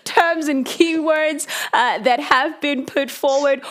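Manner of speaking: real chuckle running into the words; laughing. Real, not acted. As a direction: talk through a laugh, voice lifted high. Host laughs mid-question to panelist.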